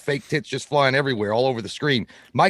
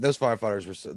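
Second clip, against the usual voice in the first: usual speaking voice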